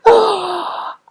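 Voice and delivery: gasp. Real, not acted. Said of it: "I gotta catch my breath".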